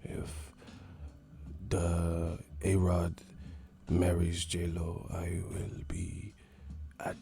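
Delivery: put-on slow, hushed accented half-whisper; mock soft-spoken boxer, low and halting; Miguel Cotto whispering. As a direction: murmur it in fragments with long pauses. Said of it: "what the fuck are you whispering".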